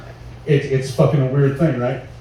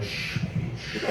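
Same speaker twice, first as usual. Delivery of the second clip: put-on hushed, breathy shushing like soothing a baby; whispered shh, as if calming an infant; soft shushing; 15 dB quieter than usual. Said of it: "it's softer tones".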